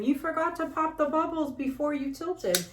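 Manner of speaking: a hint of a helpful commenter; quoting someone. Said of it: "someone said to me last time".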